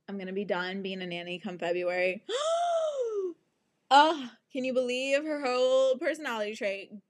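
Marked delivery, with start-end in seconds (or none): 2.3-3.3 dramatic gasp. Acted. Dramatic gasp, high far.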